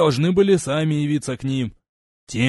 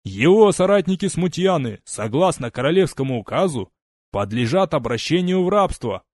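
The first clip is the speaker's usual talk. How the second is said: loud, higher public sentencing; booming proclamation; 4 semitones higher, 5 dB louder than usual. Acted. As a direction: proclaim the verdict to the troops. Legate proclaims sentence to regiment.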